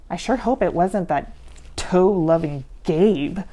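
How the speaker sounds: ordinary delivery, nothing marked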